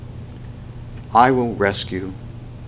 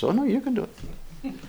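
much higher and softer cheerful voice; God's voice; 9 dB quieter than usual. Retold or imitated. God answering someone who gives up, high and soft. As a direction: brush off the despair in a light high tone. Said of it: "And God says".

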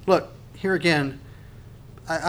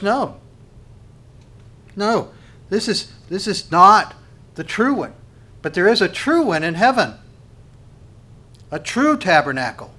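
higher-pitched, firm correction; emphatic denial; 4 semitones higher, 4 dB louder than usual. Retold or imitated. Preacher quoting the answer on where the holy place is, emphatic denial. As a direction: insist on it, punching the key words.

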